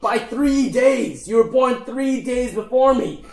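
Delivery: high, drawn-out gnome protest; indignant gnome worker. Acted. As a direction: throw it back at your boss, high and slow. Acted gnome quarrel.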